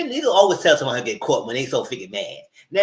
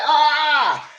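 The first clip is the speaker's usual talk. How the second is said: retold alarmed cry; 10 semitones higher, 6 dB louder than usual. Own reaction to near fight.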